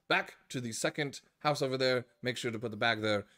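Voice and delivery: put-on chirpy old-fashioned bellhop. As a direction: greet the guests like a chirpy old-time bellhop. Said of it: "he goes".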